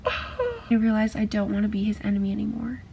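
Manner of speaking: ordinary talk, nothing marked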